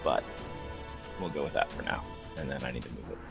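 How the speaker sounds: ordinary talk, nothing marked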